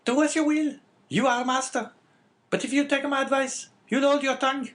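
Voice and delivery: very high, loud woman's character voice; scolding wife. Acted. Wife interrupting her husband from the stairs, scolding.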